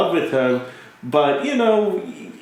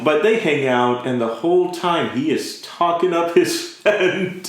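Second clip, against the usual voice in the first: ordinary talk, nothing marked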